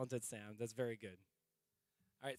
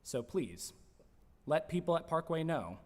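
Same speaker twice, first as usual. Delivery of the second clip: news read; 3 semitones higher, 9 dB louder than usual. Acted. News segment announcement.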